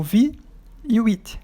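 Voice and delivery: "vi" and "it" said separately, pause between; split into syllables. Shown as a demonstration. Teacher showing students the two syllables, split apart. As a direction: break visit into "vi", pause, "it". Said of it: "Ele tem duas sílabas".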